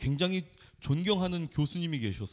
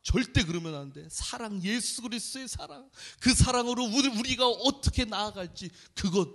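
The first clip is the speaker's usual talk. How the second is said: higher, louder lecturing tone; mock preachy professor; 6 semitones higher, 3 dB louder than usual. Professor lecturing the pastor again, pitched up and preachy. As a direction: hammer the message like a pushy lecturer. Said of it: "또 그 얘기를 하시는 거예요".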